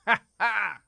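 loud creepy laugh; ha ha, hearty and high; delighted laugh. Acted. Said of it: "He laughed delightedly".

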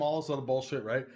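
ordinary talk, nothing marked

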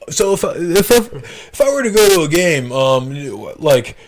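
a hint of a dopey cop; lightly voiced naive cop; high cop voice. Cop asking naive question.

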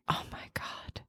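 breathy awed whisper; groggy hushed whisper. Retold or imitated. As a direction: whisper it like a woozy patient. Imitating woozy post-anesthesia partner.

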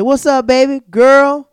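high, loud gushing hello; shrieking fan greeting; excited greeting. Retold or imitated. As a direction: call out to her with delight. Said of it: "And I said".

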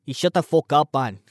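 badly faked Scottish or British accent; amateur attempt at a British-Scottish accent; terrible Scottish accent. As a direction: gripe in a clumsy mock-Scottish accent. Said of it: "doing a terrible Scottish or British accent".

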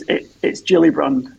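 "Gillibrand" with a hard G, stressed on the first syllable GIL, slowed down and loud; says own surname. Shown as a demonstration. Host asks guest for his surname, so "Gillibrand" is said with a hard G. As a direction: spell out "Gillibrand" slowly: hard G, GIL-li-brand. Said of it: "to pronounce your surname".

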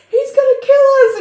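high, loud wail of terror, hammed up; mock panicked scream. Two friends screaming and shaking each other, in mock panic. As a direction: shriek it as a comic horror victim. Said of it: "they're screaming and shaking each other".

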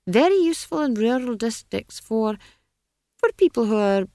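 lightly voiced wizard, groping for words; patronizing wizard. A narrator's reading. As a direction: falter politely as a condescending wizard. Wizard falters over witches' uses.

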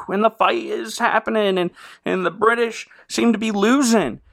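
ordinary talk

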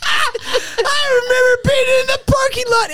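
high, squealing words through real laughter; laughing hard. Real, not acted. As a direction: crack up helplessly while telling it. Cracking up over the story.

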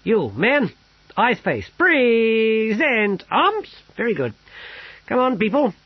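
a sergeant drilling troops; parade-ground commands. A narrator's reading. Bane ordering the guards, loud barked commands.

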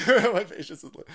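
breaking into laughter mid-sentence; laughing. Real, not acted. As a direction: laugh through the words.

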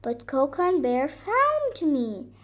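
high, loud little-deer voice; high-pitched excited report; excited baby deer. A narrator's reading. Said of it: "shouted Baby Deer".

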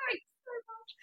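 very high mock-sweet thanks; replying to a backhanded compliment; sarcastic thanks. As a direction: squeak out an ironic thank you. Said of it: "I was like".

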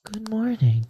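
like a gentle ASMR host greeting you; ASMR woman. Pretend. [name] demoing an ASMR voice for the hosts, high and gentle. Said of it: "it has to be a woman".

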